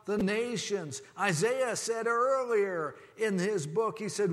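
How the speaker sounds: loud, high, impassioned preaching. Acted. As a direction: preach it out to the whole congregation. Preaching at full force.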